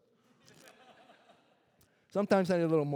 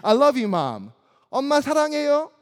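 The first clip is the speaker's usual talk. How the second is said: put-on childlike Korean endearment; 7 semitones higher, 5 dB louder, much faster than usual. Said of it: "I would tell my mom in Korean".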